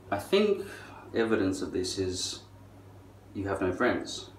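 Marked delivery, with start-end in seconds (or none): none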